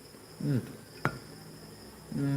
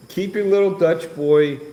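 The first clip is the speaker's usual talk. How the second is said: louder, slow teasing jab; mock teasing; 7 semitones higher, 5 dB louder than usual. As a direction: rib him playfully. Teasing William.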